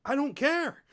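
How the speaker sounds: loud, high-pitched defiance; defiant outburst. Real, not acted. Player brushing off class bonuses, loud defiant outburst. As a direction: burst out with it, proudly unbothered.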